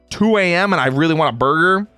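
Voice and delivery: ordinary talk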